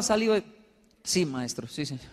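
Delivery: ordinary talk, nothing marked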